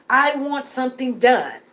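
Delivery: ordinary talk, nothing marked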